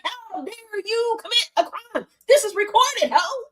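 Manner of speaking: high, sassy comeback; mock scolding. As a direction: throw it back at her. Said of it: "And he was like".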